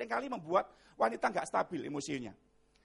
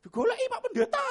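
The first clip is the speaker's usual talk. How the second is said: high-pitched call to a spouse; imitated yell; 13 semitones higher, 6 dB louder than usual. Husband calls from the bedroom about the pastor, imitated high yell. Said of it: "dia teriak".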